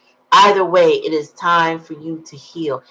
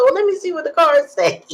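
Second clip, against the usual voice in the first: put-on boyfriend voice; 11 semitones higher, 4 dB louder than usual. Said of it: "He said".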